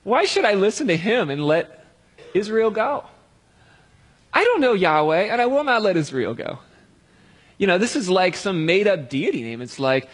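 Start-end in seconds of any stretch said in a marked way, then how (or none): 0.0-6.6 higher, dismissive king retort; a touch of royal disdain; mock haughty Pharaoh. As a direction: Pharaoh scoffing at the request. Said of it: "retorted Pharaoh".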